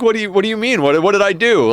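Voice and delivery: high, loud confused protest; bewildered, no idea what happened; baffled. Retold or imitated. To his brother after the blackout, high and baffled. Confused reply in retold dream.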